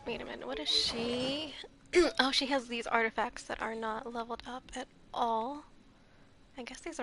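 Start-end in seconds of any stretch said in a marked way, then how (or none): none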